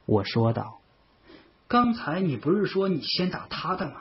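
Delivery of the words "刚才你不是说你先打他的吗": incredulous question. A narrator's reading. Friend challenges his changed story.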